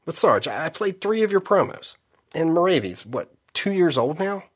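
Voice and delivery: high nervous whine; whiny private. Acted. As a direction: plead up high. Private whining to sergeant.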